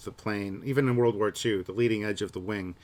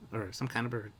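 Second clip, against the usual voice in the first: usual speaking voice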